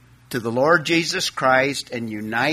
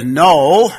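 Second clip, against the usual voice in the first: "no" hit louder and set apart; stress on no; 7 dB louder than usual. Shown as a demonstration. Preacher stressing "no" to his congregation, punched. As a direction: lean hard on "no" as the key word. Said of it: "is the emphatic first word".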